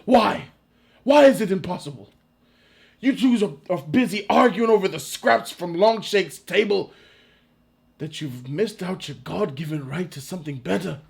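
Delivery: rousing rebel leader, lightly played; lightly acted Braveheart warrior. William Wallace rallying men to fight the English, lightly acted rebel. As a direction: play a defiant battlefield speech, hint of hero.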